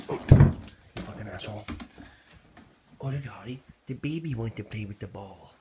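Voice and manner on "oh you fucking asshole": much louder exasperated cursing at the dog; angry outburst. Real, not acted. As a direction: blurt it out loud in sudden frustration. Dog won't drop the ball.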